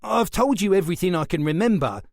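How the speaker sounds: fast, high and loud plea; panicky protest. A narrator's reading. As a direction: rush it out, scared. Frightened suspect protesting.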